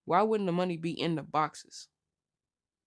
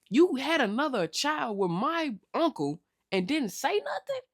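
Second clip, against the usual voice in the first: incredulous; 5 semitones higher than usual. Real, not acted. Outraged recap of Junior's mother.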